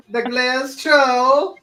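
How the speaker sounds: put-on slow, loud Boston accent with a laugh. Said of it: "It sounded like the fucking leprechaun".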